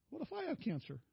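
high, soft whimper in imitation; mock tearful. Crying husband frets to his wife about his own health, mock tearful. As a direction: play the weepy, worried husband. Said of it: "I started crying".

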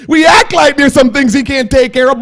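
lifted, forceful sermon delivery. Real, not acted. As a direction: proclaim it out to the room.

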